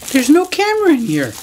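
high, hammed-up fake alarm; mock shock. Joking to viewers about an empty box, mock alarmed voice. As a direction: pretend the box is empty in horror.

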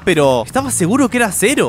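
usual speaking voice

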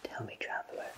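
ordinary talk

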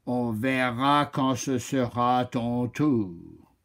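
low, slow, calm oak voice; unhurried and firm. Acted. The oak answers the pushy chameleon, low and calm. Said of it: "répondit le chêne calmement".

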